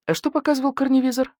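high, offhand inquiry; feigned casual question. A narrator's reading. Older badger tests the wolf's alibi, seemingly casual. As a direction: ask as if just curious. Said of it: "Как бы невзначай поинтересовался".

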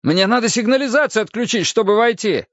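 exasperated high-pitched urging; urgent shout. Acted. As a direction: press a dazed man to listen. Shouting at a dazed man for password.

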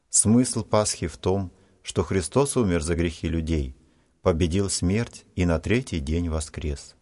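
usual speaking voice

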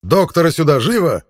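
loud, high urgent call; shouting. Acted. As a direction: yell the order toward the door. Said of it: "крикнул".

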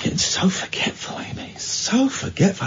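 quiet self-scolding mutter; muttering to himself. Real, not acted. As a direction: mutter it under your breath. Host muttering to himself, panicked.

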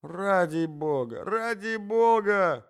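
slow plea rising high; drawn-out desperate pleading; crying out. A narrator's reading. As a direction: beg loudly, stretching each word. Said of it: "кричал Иван Андреевич".

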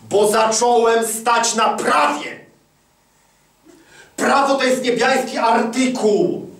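loud, high, slow proclamation; preaching at full force. Acted. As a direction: proclaim it like law from the pulpit.

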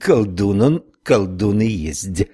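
slow drawn-out Irish brogue; drawled Irish accent. Acted. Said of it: "с непередаваемым ирландским прононсом протянула". (протяжный ирландский акцент)

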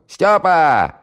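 high, loud call across the flat; bellowing. Acted. Housewife bellows for Styopa to come to the phone. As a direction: yell the name at full throat. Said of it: "заорала во всё горло".

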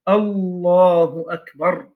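«الله أكبر» slow, with «الله» drawn out long; spoken-out takbir. Shown as a demonstration. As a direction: pronounce «الله أكبر» slowly and deliberately. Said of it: "بالنطق بها أن يقول". (تكبير منطوق ببطء مع مدّ «الله»)

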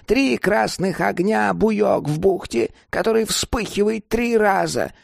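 high, impatient crone explaining; scheming old woman. Acted.

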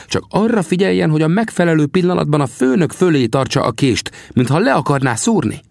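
high-pitched scout character; Sam, confident. A narrator's reading. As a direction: instruct in a high, odd voice. Sam gives instructions. (magas hangú, magabiztos figura)

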